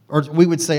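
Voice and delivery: normal talking voice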